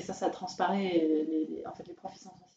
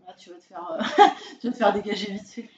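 laughing while talking; 11 dB louder, faster than usual. Real, not acted. Laughing through the warning.

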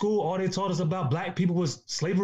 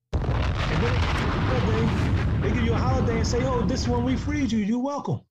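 higher, louder sarcastic generosity; mock gracious; 4 semitones higher, 4 dB louder than usual. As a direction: hand it over like a smug favour. Mocking the holiday as a gift.

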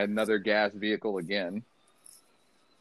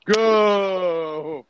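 high, drawn-out shout; shortened hype shout; 6 semitones higher, 4 dB louder than usual. Shown as a demonstration. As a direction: yell one long GO. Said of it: "it's gonna get shortened to go".

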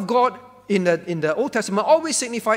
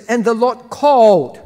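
"the Lord called" read out loud, the Lord punched; stress on the Lord; 2 semitones higher, 9 dB louder than usual. Shown as a demonstration. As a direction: hammer the words "the Lord" as you read the verse. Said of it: "Again, the emphasis is the Lord".

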